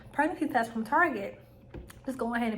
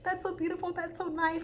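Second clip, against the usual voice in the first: teary; 8 semitones higher, 4 dB quieter than usual. Retold or imitated. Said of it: "kinda tearing up".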